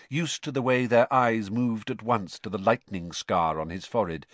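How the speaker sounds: usual speaking voice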